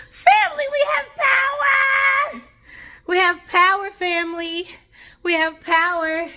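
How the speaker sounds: tearful, high, loud cry of joy; overjoyed crying shout. Real, not acted. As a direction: shout the news through happy tears. Power returns after outage, overjoyed.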